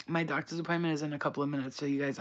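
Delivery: ordinary talk, nothing marked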